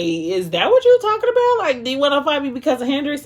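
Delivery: high-pitched, incredulous questioning; amused confusion. Retold or imitated. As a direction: voice jumps up as if baffled and tickled. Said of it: "Bella was laughing 'cause she really didn't know".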